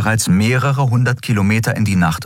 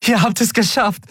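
much higher, joyful cheer; jubilant. A narrator's reading. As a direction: cheer with pure relief. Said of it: "jubelte Endriel".

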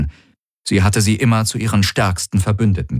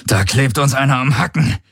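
raised pitch, tense and strained; through gritted teeth; 6 semitones higher than usual. A narrator's reading. Pilot warning the crew of a pursuer, through gritted teeth. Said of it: "brachte Garland mit zusammengebissenen Zähnen hervor".

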